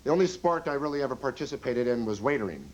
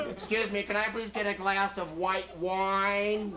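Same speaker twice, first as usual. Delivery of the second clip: much higher, slow, whiny mock order; demanding lady diner; 6 semitones higher, slower than usual. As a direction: nag the waiter in a put-on high voice. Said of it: "their tone of voice when they order".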